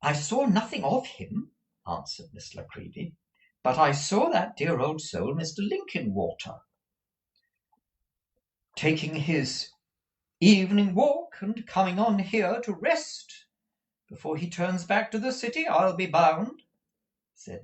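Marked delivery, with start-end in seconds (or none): 0.0-1.5 high, brisk little lady; high-pitched spinsterly chatter; chirpy Miss La Creevy. Acted. 3.6-16.6 high, animated lady's voice; high, lively, gossipy delivery; chatty Miss La Creevy. Acted.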